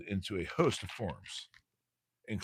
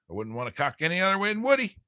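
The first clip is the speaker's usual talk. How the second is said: hammed-up joke; 10 semitones higher, 9 dB louder, faster than usual. Joking pun, hammed-up.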